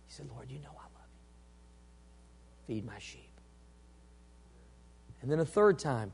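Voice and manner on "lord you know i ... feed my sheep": very soft, low, reverent murmur; hushed exchange. Acted. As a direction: speak it low and nearly whispered. Hushed exchange of Peter and Jesus.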